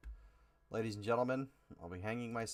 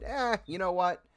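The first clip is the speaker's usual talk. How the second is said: higher-pitched mock shrug; dismissive; 9 semitones higher, 4 dB louder than usual. Voicing Fox deciding to drop the show, mock dismissive. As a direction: toss it off with a put-on careless 'eh'.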